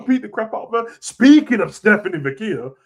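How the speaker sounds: ordinary talk, nothing marked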